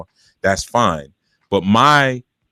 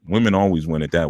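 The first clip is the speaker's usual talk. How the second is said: ordinary talk, nothing marked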